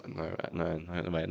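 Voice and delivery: ordinary delivery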